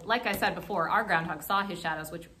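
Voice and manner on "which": plain reading voice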